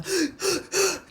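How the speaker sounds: imitated sharp sobbing intakes of air; sobbing gasps. Shown as a demonstration. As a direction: gasp in like a sobbing baby. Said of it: "so bad and you sobbing".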